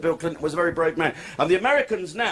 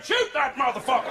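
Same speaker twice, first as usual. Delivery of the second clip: mock pilot yell; 8 semitones higher than usual. Pilot spotting Welsh dragon flag.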